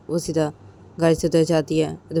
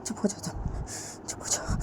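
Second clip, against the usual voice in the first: soothing whisper; 4 semitones higher, 11 dB quieter than usual. Acted. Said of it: "हक कर लेती है".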